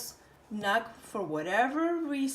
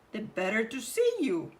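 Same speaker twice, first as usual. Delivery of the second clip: wolf voice. A narrator's reading. Wolf answers Grandma.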